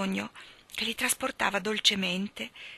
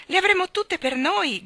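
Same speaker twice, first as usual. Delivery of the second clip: put-on much higher, loud happy cry of a girl; excited shout; 11 semitones higher, 6 dB louder than usual. A narrator's reading. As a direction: call it out in delight. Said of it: "gridò Jane".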